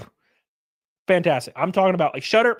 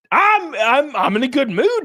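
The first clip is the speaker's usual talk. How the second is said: raised, loud stammering complaint; exasperated protest; 5 semitones higher, 4 dB louder than usual. Real, not acted. Streamer complaining to Topher, loud exasperation. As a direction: protest that you were in a good mood.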